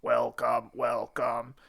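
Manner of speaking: low, soft, monotone robot; deep, hushed robot imitation. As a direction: intone it like a machine greeter. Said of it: "in a robot voice".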